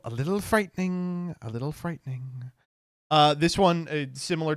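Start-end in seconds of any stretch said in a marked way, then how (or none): 1.4-2.5 uneasy half-whispered echo; a quiet, creeped-out repeat; softer, trailing off. Real, not acted. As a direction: drop the repeat to a hushed murmur. Repeats phrase, second drops away.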